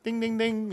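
high sung-out bell dings; imitated bell. Preacher flagging the old guy's pride, imitated bell. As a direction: chime it like an alert bell.

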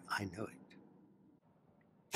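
a breathless whisper of certainty. Acted. King confiding to his journal in a whisper. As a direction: hush it, as if to yourself. King's private journal, quiet conviction.

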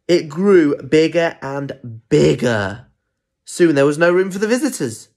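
loud, building storyteller voice; dramatic story narration. A narrator's reading. Beetroot crowding out the visitors, dramatic story narration. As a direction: crank up the drama for small listeners.

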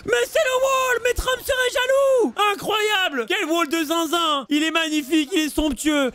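soaring high shout of amazement, easing slightly; ecstatic shoutcast. Real, not acted. Caster hailing a magnificent wall, ecstatic shouting. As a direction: erupt in high-pitched awe at the play.

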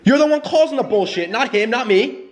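high, furious demand to get out; angry friend ordering her out of the car; angry yelling. Real, not acted.

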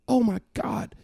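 high, stunned exclamation; shocked. Retold or imitated. Father reacts to his daughter's story, stunned. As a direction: gasp it out in disbelief. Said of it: "And I'm like".

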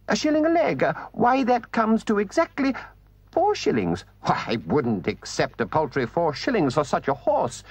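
very high, haughty refusal; scornful Toad. A narrator's reading. Toad scorns four shillings for his horse, high and haughty. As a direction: sniff at the paltry sum.

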